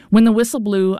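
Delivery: ordinary delivery, nothing marked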